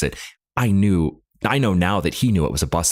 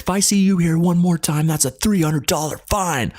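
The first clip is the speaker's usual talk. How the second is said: high-pitched mock angry cop; mock cop yell; 11 semitones higher than usual. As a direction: snap back at the driver like an irate cop. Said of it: "the cop is just screaming".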